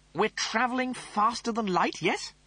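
high, snooty officer voice; pompous Rimmer voice. Acted. Rimmer reasons.

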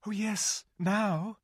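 put-on high, eager girl's voice. A narrator's reading. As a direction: bubble over with joy. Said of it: "said Lucy".

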